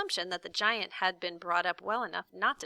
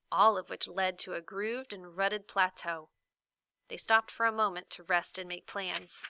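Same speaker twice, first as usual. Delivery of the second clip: ordinary delivery, nothing marked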